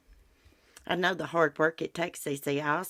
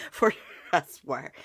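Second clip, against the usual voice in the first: laughing. Real, not acted. Laughs after threatening neighbour.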